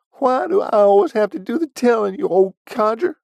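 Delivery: high, loud cranky geezer voice; outraged old man Willie. A narrator's reading. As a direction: squawk like an offended old codger. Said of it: "with hands flailing about in outrage".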